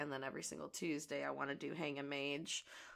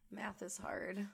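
normal talking voice

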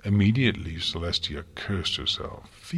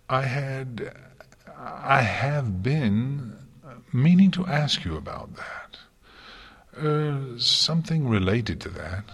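higher, halting request; nervous, hesitant; 6 semitones higher, slower than usual. A narrator's reading. Twilight working up to asking the Princess, nervous. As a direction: stumble and restart, nervously working up to it. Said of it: "a picture of nervousness".

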